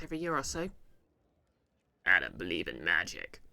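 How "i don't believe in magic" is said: very low, level put-on hero voice; terrible Indiana Jones. Player mimicking a film hero for viewers, badly faked and low. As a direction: do a bad, deep impression of an adventurer. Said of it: "that was a terrible Indiana Jones voice".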